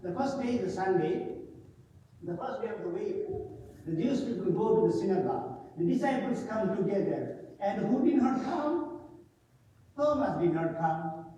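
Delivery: normal talking voice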